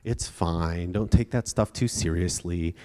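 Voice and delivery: low, soft mock-comforting dad; soothing dismissal. Dad patting daughter on the head, mock soothing. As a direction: placate a child and brush it off. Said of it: "pat her on the head and be like".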